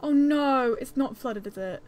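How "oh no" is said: dismayed cry. Real, not acted. Sees den flooded.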